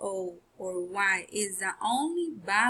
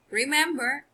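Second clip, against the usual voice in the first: ordinary talk, nothing marked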